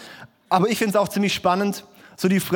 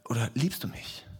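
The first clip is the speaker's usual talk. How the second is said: much softer, intimate question; Jesus asking once more, hushed; soft repeated question; 7 semitones lower, 9 dB quieter than usual. Retold or imitated. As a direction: ask it quietly, searching. Jesus asks again.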